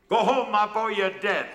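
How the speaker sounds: high, loud heckle from the stage; shouted taunt, pitched right up; yelled warning. Retold or imitated. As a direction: yell it across a packed hall. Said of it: "and yells".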